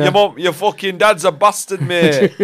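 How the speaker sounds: high, loud, slow Yorkshire insult; slow Yorkshire lad, loud; Yorkshire accent. Retold or imitated. Mocking Huddersfield locals.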